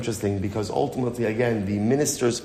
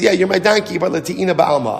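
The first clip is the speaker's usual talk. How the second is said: high, louder brush-off in an acted argument; dismissive protest; 7 semitones higher, 4 dB louder than usual. Bilam arguing back at his donkey, dismissively protesting. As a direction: concede it, then wave it away.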